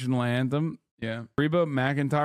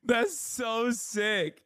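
high, slow, delighted; thrilled by the clip; excited squeal; 13 semitones higher, much slower than usual. Real, not acted. Reacting gleefully to the clip.

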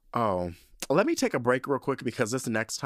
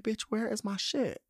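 frantic high question; 13 semitones higher, 3 dB quieter than usual. Retold or imitated. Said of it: "I'm like".

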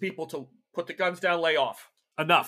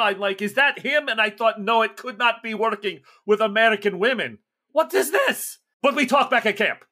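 the slick contact explaining in a raised voice; Yevgeny's voice; 6 semitones higher, 6 dB louder than usual. Acted. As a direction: NPC urging the group to move. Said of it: "he goes".

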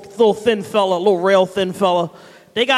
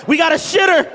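imagined shout; 7 semitones higher than usual. Retold or imitated. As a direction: yell it out like staff spotting him.

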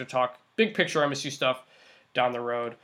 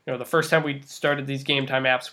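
normal talking voice

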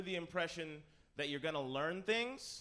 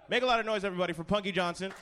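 loud, fast hype introduction; announcer intro; 5 semitones higher, 9 dB louder, faster than usual. Acted. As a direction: bring the guest on like an MC. Introducing a panelist.

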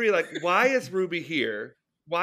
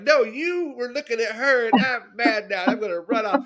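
mock petulant; 6 semitones higher, 4 dB louder than usual. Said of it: "they're like".

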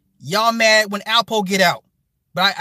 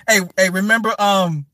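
usual speaking voice

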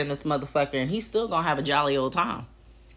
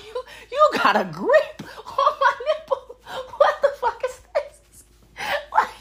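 laughing disbelief. Retold or imitated. Laughing at the memory.

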